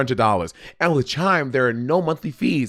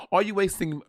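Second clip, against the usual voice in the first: badly faked British accent, softer than usual; mock-British presenter, hushed ad read; 6 dB quieter than usual. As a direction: do a British TV show voice. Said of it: "I'll do British show".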